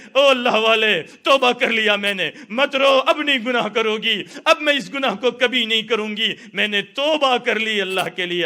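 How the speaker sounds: loud, high, pleading through tears; tearful repentance. Acted. The woman tearfully repents at the saint's feet, loud and high. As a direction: beg forgiveness while crying. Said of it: "رو رہی ہے".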